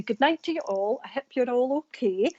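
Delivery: a lightly broadened Scottish "Good night to you all"; broad Scottish accent. Shown as a demonstration. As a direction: let her home Arbroath Scots show through. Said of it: "this is how I would talk".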